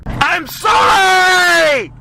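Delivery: very high, loud, drawn-out sobbing scream; screaming outburst. Acted. Comedian apologizing to the audience in a screaming outburst. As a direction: wail the apology at the top of your lungs. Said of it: "Sorry for that last outburst".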